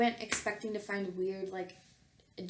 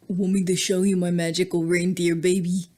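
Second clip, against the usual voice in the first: much louder, hammed-up sultry voice; seductive Bernard; 3 semitones lower, 14 dB louder than usual. Bernard flirting with his bride, hammed-up sultry. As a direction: ham up the cheesy come-on. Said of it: "He giggled and winked".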